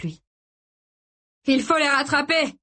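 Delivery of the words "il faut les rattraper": high, urgent cry over chaos; raised, high-pitched order; urgent shout. A narrator's reading. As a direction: shout it to rally him. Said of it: "lui cria Tony".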